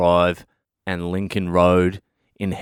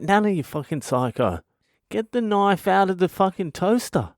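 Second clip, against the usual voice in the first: hammed-up alarm, voice jumping high; mock panicked yell; 11 semitones higher than usual. Yelling at nana with knife.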